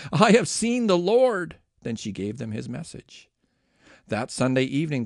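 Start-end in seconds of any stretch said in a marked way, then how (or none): 0.1-1.6 higher, louder and slow with wonder; joyful awe; excited announcement. A narrator's reading. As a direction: proclaim it to them, breathless with joy. Mary announces the risen Lord.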